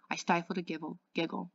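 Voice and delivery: ordinary delivery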